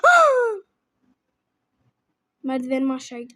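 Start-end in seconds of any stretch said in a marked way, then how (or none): 0.0-0.6 high, loud "aah!"; short high-pitched shriek; startled scream. Real, not acted. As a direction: yelp out loud in sudden alarm. Sudden scream during the game.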